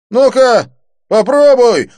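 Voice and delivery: high, loud taunting yell. A narrator's reading. The thug taunts Didier, yelling. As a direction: yell a challenge. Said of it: "завопил блондин".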